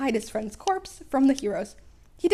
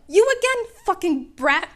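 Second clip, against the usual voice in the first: loud, higher snarling villain; villain hissing; 8 semitones higher, 8 dB louder than usual. A narrator's reading. Sludge villain snarls at the returning boy. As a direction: spit it out like a monster. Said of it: "the villain hissed".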